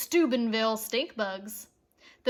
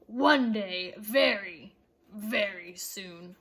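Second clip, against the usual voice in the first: sinister promise; 6 semitones lower, slower than usual. A narrator's reading. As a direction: lower the voice into a slow threat. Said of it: "Mister Krupp vowed".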